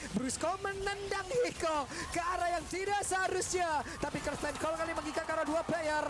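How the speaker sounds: rising play-by-play call; esports caster. Acted. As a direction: call the action, building hype. Play-by-play of a fight.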